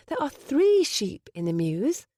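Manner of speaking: high, slow correcting tone; Penny voice. Acted. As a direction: correct him primly.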